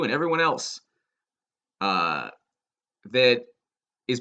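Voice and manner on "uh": usual speaking voice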